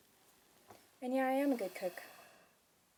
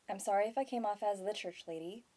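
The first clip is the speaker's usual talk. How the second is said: ordinary talk, nothing marked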